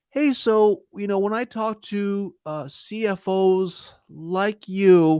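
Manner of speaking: high, drawn-out, hesitant pitch; weak, uncertain. Shown as a demonstration. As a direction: stall through it without conviction. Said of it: "the weaker and wrong way to do it".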